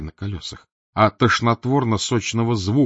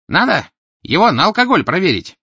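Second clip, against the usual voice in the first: higher, louder matter-of-fact tenor; businesslike tenor; 10 semitones higher, 8 dB louder than usual. Acted. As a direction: suggest it like a busybody. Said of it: "деловито предложил надтреснутый тенор". (деловитый тенор)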